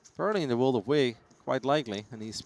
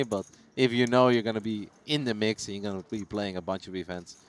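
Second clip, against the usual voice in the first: ordinary talk, nothing marked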